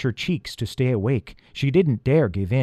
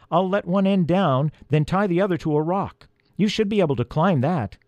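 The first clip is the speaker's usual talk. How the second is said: higher, raised instructing voice; calling down; 7 semitones higher than usual. A narrator's reading. Peter explains the rescue to the elf, calling down. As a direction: call instructions to someone below.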